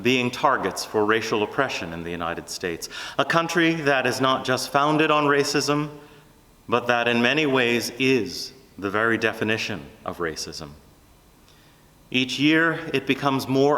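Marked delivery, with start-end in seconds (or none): none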